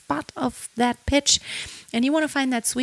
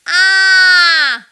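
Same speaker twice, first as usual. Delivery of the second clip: loud, higher "ah" with ping; twangy ah; 5 semitones higher, 9 dB louder than usual. Shown as a demonstration. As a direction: cut through with a twangy ah. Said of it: "kinda like this".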